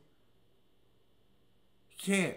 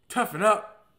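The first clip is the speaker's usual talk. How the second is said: loud, raised, punchy order; higher, louder tough-love call; tough-love command; 6 semitones higher, 9 dB louder than usual. Acted. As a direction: tell them sharply to man up. Acting generation's tough-love shout.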